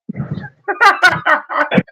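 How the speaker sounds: a sudden high mocking laugh; gloating high-pitched laugh. Real, not acted. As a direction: laugh at him, high and gleeful. Laughing after insulting rival.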